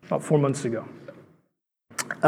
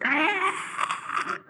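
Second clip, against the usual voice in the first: high, soft imitated screech; thin mock scream, held long; demon scream; 9 dB quieter than usual. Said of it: "It's like demons screaming".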